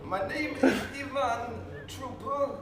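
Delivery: slow, high, hammed-up Polish accent. Shown as a demonstration. Guest showing off his Polish accent to the hosts. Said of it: "could you give us an example of a".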